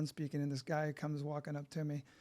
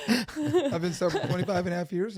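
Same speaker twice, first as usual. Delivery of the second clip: laughing; 3 semitones higher, 10 dB louder than usual. Real, not acted. Repeats laughing loud.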